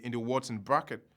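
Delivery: ordinary delivery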